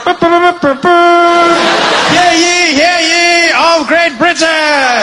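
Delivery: high, loud fanfare then slow proclamation; herald announcement. Acted. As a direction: trumpet in like a town crier.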